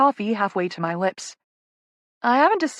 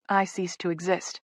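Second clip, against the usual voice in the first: ordinary delivery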